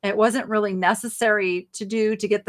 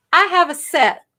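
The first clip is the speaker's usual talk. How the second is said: higher, louder Southern twang; Southern accent; 6 semitones higher, 7 dB louder than usual. Real, not acted. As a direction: slip suddenly into a Southern accent. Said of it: "go into my Southern accent".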